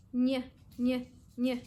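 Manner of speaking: short "ñ" said as "nyuh"; isolated letter sound. Shown as a demonstration. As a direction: say "ñ" as a brief nyuh, evenly spaced. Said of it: "los sonidos".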